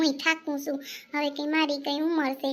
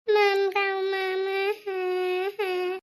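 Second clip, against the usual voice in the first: put-on toddler babble; 5 semitones higher, much slower than usual.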